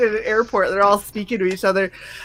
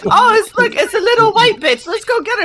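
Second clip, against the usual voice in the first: higher mock voice of sneering thugs; mocking the men; 12 semitones higher than usual. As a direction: play the cocky men sizing her up. Voicing men spotting her.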